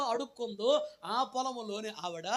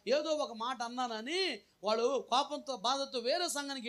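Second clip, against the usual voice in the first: higher-pitched, urgent preaching warning; fervent warning sermon; 6 semitones higher than usual. Acted. Pastor warning the congregation not to leave, urgent and high. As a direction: raise the pitch and press the warning. Pastor warns about leaving church.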